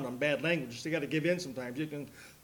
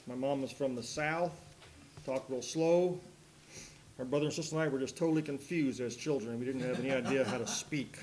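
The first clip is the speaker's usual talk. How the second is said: normal talking voice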